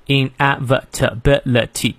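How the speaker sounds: "inevitability" sounded out beat by beat; seven separate syllables. Shown as a demonstration. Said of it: "一共inevitability七个音节". (“inevitability”分七个音节逐个念)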